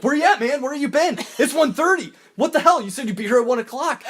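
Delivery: very high, loud incredulous scolding; hammed-up outraged Cruise; exasperated scolding. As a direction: berate him for being late. Cruise scolding late driver.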